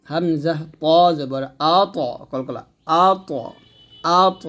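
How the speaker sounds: আবে, আক, আদ, আত with the final stop bounced out, slow; qalqala echo. Shown as a demonstration. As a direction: make the last consonant of আদ and আত pop and echo. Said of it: "কলকলা আদায় করে পড়তে হবে".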